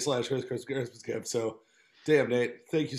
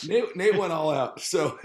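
louder, higher, slowed by laughter; laughing; 4 semitones higher, 6 dB louder, slower than usual. Real, not acted. Host thanking friend for the gift, laughing. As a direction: talk while still recovering from a laugh.

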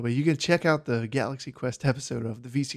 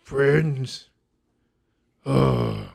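disgusted orc; 2 semitones higher than usual. Acted. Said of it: "the orc kinda turns around and says".

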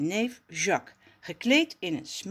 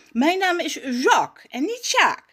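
higher, louder snooty correction; posh voice; 9 semitones higher, 7 dB louder than usual. Acted. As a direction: correct the name haughtily. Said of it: "zegt hij met bekakte stem".

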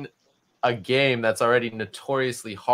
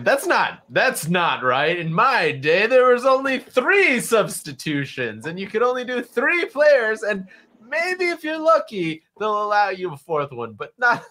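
lightly voiced old man complaining, a bit higher; grumpy old man; 8 semitones higher than usual. A hint of an old man complaining to his co-host about substitution rules. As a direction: grumble like an old-timer about the good old days, lightly put on. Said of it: "I felt like an old man going".

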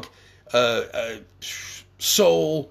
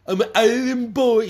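mock rapper line, pitched much higher and louder; 12 semitones higher, 4 dB louder than usual. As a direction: sneer out their catchphrase like a parody. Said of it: "they were trying to do that fucking song".

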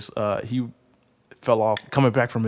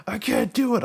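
put-on higher, straining kid voice; panicked kid; 9 semitones higher, 3 dB louder than usual. Boy holding up the falling motorcycle, panicking. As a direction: whine like an eight-year-old losing his grip. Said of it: "I'm like".

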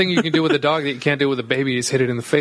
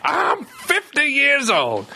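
mock old man; 10 semitones higher, much slower than usual. Mocking teasing jab.

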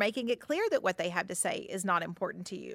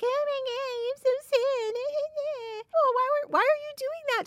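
very high-pitched fake whiny crying; hammed-up mock sobbing voice. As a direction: mimic someone's tears to belittle them. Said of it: "someone is mocking you, if you're crying".

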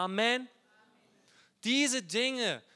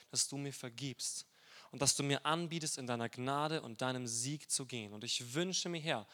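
prayer; 4 semitones lower than usual. Real, not acted. Pastor closes with prayer.

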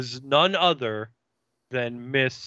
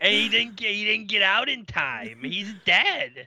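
Tammy voice; 8 semitones higher than usual. Retold or imitated. Tammy greeting Angel in a high put-on voice. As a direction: play the peppy slayer, pitched way up.

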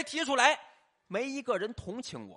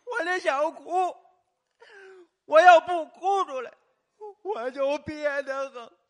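hammed-up wailing sob, high and loud, drawn out; comic fake weeping, high and stretched; mock crying; 7 semitones higher, 6 dB louder, slower than usual. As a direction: wail like a grown man faking tears. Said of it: "你今儿净哭的呀".

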